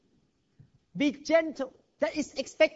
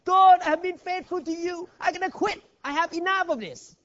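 higher, louder griping to God; retold complaint, pitched up and loud; whiny complaint; 6 semitones higher, 4 dB louder than usual. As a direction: moan that you've had enough. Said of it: "he complained again".

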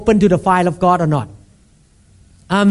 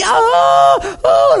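put-on baby-like crying; 13 semitones higher than usual. Pastor showing the church how he cried, mock baby wail. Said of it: "cry like a baby".

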